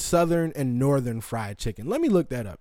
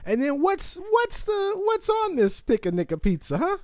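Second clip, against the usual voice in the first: much higher, put-on voice asking; mock customer. Host voicing a customer asking what's on the pizza. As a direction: squeak the question like a caricature.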